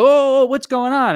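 high, slow, taken-aback question; startled. Retold or imitated. Caught off guard by mom.

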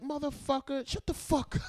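very high-pitched irritated outburst; squeaky-high retold scolding; mock cussing out. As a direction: imitate an exasperated detainee cursing someone out. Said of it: "He starts cussing me out".

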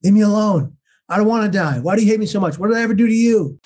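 re-enacted cry of desperate protest, pitched well up; retold anguished yell. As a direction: act out yelling aloud on a crowded bus. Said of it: "I yelled aloud on a crowded bus".

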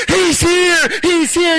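high, loud, ecstatic shout; excited scream. Acted. Preacher acting a disciple shouting that the king has come. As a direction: announce it to everyone around. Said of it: "You're screaming".

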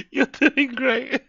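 high, slow words through the laughter; sarcastic praise while cracking up; giggled sarcasm; laughing. Real, not acted. Chat roasting his audio.

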